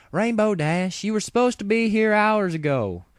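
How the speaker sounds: high, loud, exasperated farm-pony call; Applejack crying out. A narrator's reading. Applejack calling out to late Rainbow Dash, exasperated and high. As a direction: scold a late friend from the orchard. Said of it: "Applejack cried".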